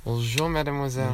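showing off his school French with a mock French accent. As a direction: charm her like a cartoon Frenchman. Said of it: "French accent".

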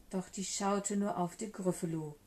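plain reading voice

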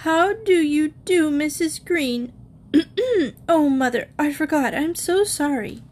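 lightly voiced little girl, near tears; tearful little girl. A narrator's reading. Sharon to her mother, a lightly tearful little girl. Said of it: "eyes filled with tears".

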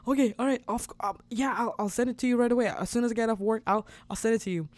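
fast, high, tumbling babble; excited babbling. Retold or imitated. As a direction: rush the words like you can't stop. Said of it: "I started babbling".

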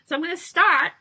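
high, loud grand opener; announcing. Real, not acted. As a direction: kick it off like an announcement. Announcing the diary reading.

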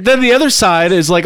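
ordinary talk

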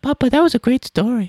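very high, exaggerated British child voice; hammed-up adoring little child; piping waif. Son mock-doting on his dad, piping British waif. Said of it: "one hour of like".

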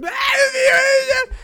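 very high, loud mock cry; mocking wail. Imitating friends mocking his story about his uncle, mock wailing. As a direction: wail it like an over-the-top parody. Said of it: "me dicen".

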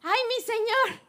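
high cry of alarm; panicked exclamation. Acted. The servant repeating his cry to Elisha, frightened. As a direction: gasp it out in fright. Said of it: "exclamó".

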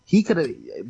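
ordinary talk

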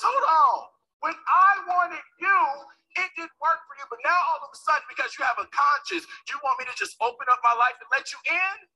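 high, loud, bitter challenge; angry retort. Retold or imitated. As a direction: throw it back at him. Angry reply to father.

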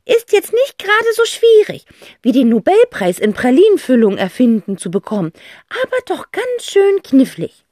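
high, loud musing aunt; eccentric aunt. A narrator's reading. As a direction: weigh the problem theatrically. Aunt figure voice.

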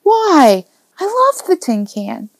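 put-on high, plaintive child's protest; anguished boy. A narrator's reading. Boy mourns his friend's old car, anguished. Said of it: "Joe's face contorted with anguish".

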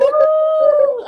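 loud, high cheering whoop; excited whoop. Real, not acted. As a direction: celebrate with a big whoo. Cheering first comedian welcome.

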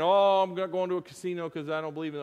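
fast, mock self-righteous churchgoer; lightly voiced pious objection; prim refusal. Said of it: "I'm just saying".

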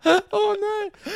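ordinary delivery

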